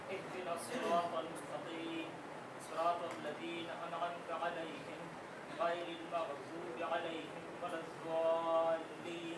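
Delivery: chanted tajweed recitation; Quran recitation. Real, not acted. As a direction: recite the Fatiha in melodic chant. Student recites Fatiha.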